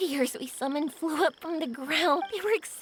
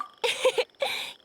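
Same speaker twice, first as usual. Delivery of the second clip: airy laugh; 7 semitones higher than usual. Acted. Laughing at her own wildness.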